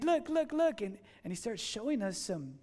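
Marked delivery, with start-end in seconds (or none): 0.0-0.8 higher, eager urging; pointing things out, thrilled; a touch of eager host; excited contact. Retold or imitated. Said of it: "he's really excited".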